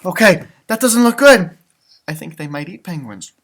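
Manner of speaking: ordinary delivery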